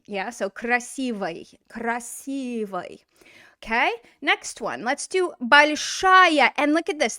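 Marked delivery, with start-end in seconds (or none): 0.5-3.0 "красивой" slowly, stress on "си", ending reduced to "vuy"; unstressed reduced ending. Shown as a demonstration. Teacher modelling the word for learners, slow with reduced ending. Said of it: "It's not stressed. Here's the stress on the word". 5.4-6.5 "большая" slow and loud, punching "ша"; stress on end. Shown as a demonstration. Teacher showing where the stress falls, end-stressed "большая". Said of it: "The stress is on the end".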